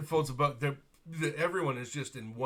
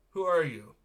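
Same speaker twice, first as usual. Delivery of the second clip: sharp demand; 6 semitones higher, 11 dB louder than usual. Acted. Noble demands names sharply.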